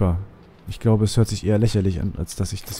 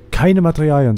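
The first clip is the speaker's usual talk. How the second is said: loud, raised pitch; sudden higher, louder complaint; exasperated outburst; 7 semitones higher, 7 dB louder than usual. Real, not acted. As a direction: exclaim it in frustration. Frustrated at lacking materials.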